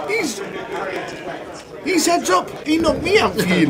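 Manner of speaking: put-on high-pitched, botched Russian accent; bad Russian accent. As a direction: imitate a Russian player, badly and very high. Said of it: "That's my best Russian accent".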